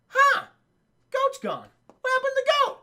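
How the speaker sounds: high-pitched, baffled imitation; puzzled kid impression. Acting out Tim noticing the goat missing, puzzled.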